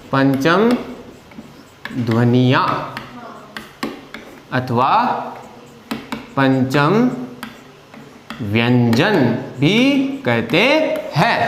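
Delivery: slow and low, word by word with long pauses; slow dictation. Shown as a demonstration. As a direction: dictate each term so students can write. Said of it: "dictating slowly to class".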